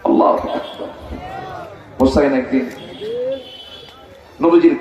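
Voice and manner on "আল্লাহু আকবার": hushed takbir. Real, not acted. Hushed exclamation after story climax.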